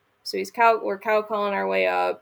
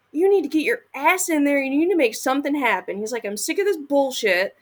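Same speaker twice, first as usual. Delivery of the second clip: higher, pushy, mock-heated nagging; imitating angry husband; 6 semitones higher than usual. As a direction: replay his irritated push to go in. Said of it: "And he got pissed".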